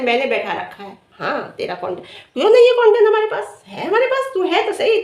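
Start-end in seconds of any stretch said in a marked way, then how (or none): 2.3-5.0 loud, high-pitched jeer; taunting retort. Real, not acted. Firing back at a rival over content, taunting.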